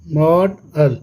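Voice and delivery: "मॉड अल" broken into two beats, मॉड and अल; syllable by syllable. Shown as a demonstration. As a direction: pause between मॉड and अल. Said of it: "syllable में divide करते हैं". ("मॉड अल" को दो हिस्सों में तोड़कर)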